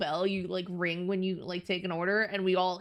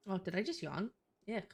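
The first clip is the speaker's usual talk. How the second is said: normal talking voice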